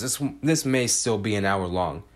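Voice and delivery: ordinary delivery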